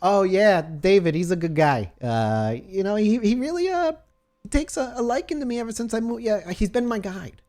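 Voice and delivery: high, put-on flustered voice; bashful nurse Susie. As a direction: gush about him up high.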